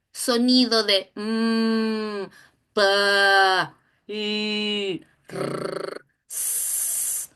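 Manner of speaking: mm, pa, i, r, s as bare phonemes, r and s only hissed; letter sounds. Shown as a demonstration. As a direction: isolate each sound for a child. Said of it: "Es el sonido".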